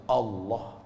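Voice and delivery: soft, low single word; calm quiet answer. Acted. The Prophet answers the sword with a calm word. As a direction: answer the threat with quiet faith.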